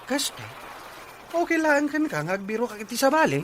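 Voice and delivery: can't believe what was just said; shocked. Acted. Spouse hearing the partner would look elsewhere, shocked disbelief.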